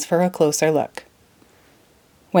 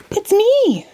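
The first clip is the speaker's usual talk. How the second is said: much higher, louder tiny shout; 13 semitones higher, 4 dB louder than usual. Acted. Tiny Alice shouting up at the dodo, high and loud. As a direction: yell up to be heard. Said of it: "shouted Alice as loudly as she could".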